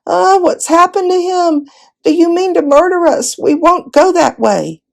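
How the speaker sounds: high, wailing cry of fear. A narrator's reading. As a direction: wail it out, panicked. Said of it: "set up a wailing".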